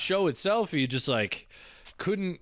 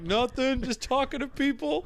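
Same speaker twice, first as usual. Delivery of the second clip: high-pitched, too-innocent excuse; innocent teen lie; 10 semitones higher than usual. Retold or imitated. Teen caught by dad at night, lightly faked innocence. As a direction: play it cool while caught red-handed. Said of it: "I'm like".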